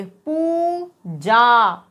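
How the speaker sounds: Puja with both vowels drawn far out, very slow; long vowel sounds. Shown as a demonstration. Teacher showing the long sound, Puja slowly stretched out. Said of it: "sound देखिए".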